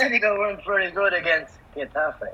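flat robotic voiceover read; machine-like template announcement. Pretend. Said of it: "And the robotic voice".

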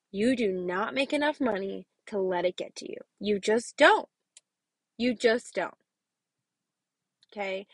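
usual speaking voice

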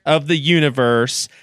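normal talking voice

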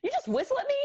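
put-on high squeaky outraged kid, laughing; laughing mock outrage. Imitates the boy's outrage, laughing.

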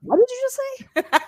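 mock outrage. To the classmate, high mock outrage. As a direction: pretend to be appalled at him. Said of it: "I'd be like".

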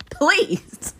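normal talking voice

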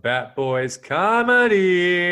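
big, drawn-out show title; hyped closing announcement; announcer sign-off. Pretend. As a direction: stretch the name out loud and high.

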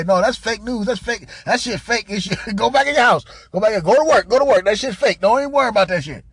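mock loud, dismissive orders; loud dismissal. Comic imitating official shooing people back indoors, loud dismissal. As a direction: bark it out to wave everyone off.

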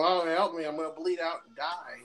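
mock helpless victim. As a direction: whine for help in jest. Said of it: "sit there and go".

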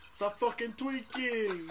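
slow, drawn-out mock command to a tweaker; mocking a show cop yelling stop; mock cop bark.

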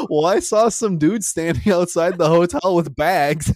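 high, loud, slow defensive excuse; dad's lame excuse. Retold or imitated. Dad explaining why he thought there was valet, slow loud excuse. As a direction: play dad justifying himself, sheepish but loud. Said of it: "He's like".

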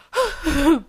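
loud, heavy sigh; exasperated sigh. Real, not acted. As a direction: blow out an exasperated breath. Venting about father's double standard.

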